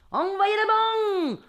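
high, slow southern French holler; hammed-up southern French accent. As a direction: bellow a bad imitation of Marseille accent. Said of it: "Je prends mon plus bel accent du Sud". (faux accent du Sud, crié et traînant)

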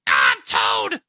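raspy, low shouted cartoon voice; Toad. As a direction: shout it out like the game character. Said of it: "sounds very similar to the, like".

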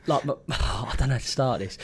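ordinary delivery

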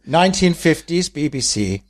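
put-on old-fashioned posh announcer accent; clipped fifties BBC presenter. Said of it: "I am pretending I'm from the B B C".

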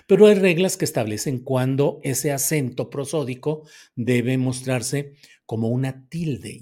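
normal talking voice

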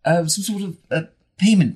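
halting, hesitant plea full of ums; fumbling for words. Acted. Adventurer pleading for a payment plan, fumbling the words. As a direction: feel your way through the offer, stopping and restarting. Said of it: "I start sort of fumbling with my, with my words".

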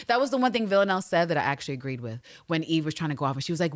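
normal talking voice

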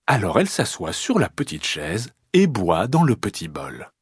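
usual speaking voice

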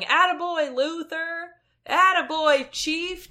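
high, loud cheering from the bleachers; imitated crowd yell. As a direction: shout it like a hoarse fan cheering on. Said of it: "voiceless man in the crowd yelling".

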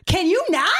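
high, loud indignant donkey voice; a fed-up donkey talking back. Retold or imitated. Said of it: "we hear the donkey go".

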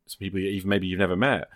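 ordinary delivery

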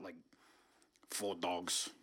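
ordinary talk, nothing marked

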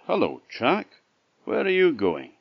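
bull's greeting. A narrator's reading. Bull hails Jack on the road, high lilting call.

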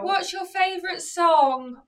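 put-on slow, high, loud drunken question. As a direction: quiz the waitress like a drunk. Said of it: "So he's really drunk".

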